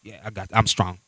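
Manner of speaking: low, quiet macho boasting; mock tough-guy bravado. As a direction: play the buff guy acting unbothered. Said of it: "big men and they're all tatted up".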